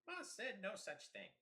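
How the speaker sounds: squeaky goblin arguing; high goblin voice. Acted. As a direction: pitch up as a goblin. Goblins squabbling.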